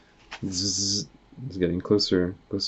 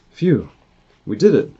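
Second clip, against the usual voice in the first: higher, louder victory shout; relieved cheer; 4 semitones higher, 7 dB louder than usual. Acted. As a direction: sigh out then cheer. Heroes relieved after door shuts.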